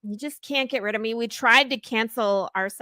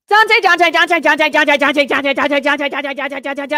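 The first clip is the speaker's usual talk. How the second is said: rapid-fire name chant, loud and high, easing off; insistent pestering; 4 semitones higher, 10 dB louder than usual. Acted. Host nagging her co-host for attention, insistent pestering. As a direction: badger him with his name nonstop.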